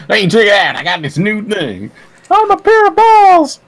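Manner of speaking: high, loud cartoon character voice; cartoon truck nuts. Playing Mater's truck nuts introducing himself, goofy and loud. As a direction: belt it out in a silly, high put-on voice.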